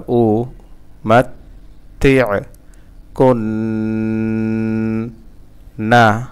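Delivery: "উমাত্তি'কুন্না" slowly split, long hummed nn; broken syllables with ghunnah. Shown as a demonstration. Teacher demonstrating for learners, syllable by syllable with ghunnah. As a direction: chop "উমাত্তি'কুন্না" apart and hold the nasal n. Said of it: "গুণনা করবেন".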